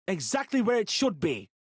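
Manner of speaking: high, loud curt answer; angry. Acted. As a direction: snap back in anger. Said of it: "uncharacteristic anger in his tone".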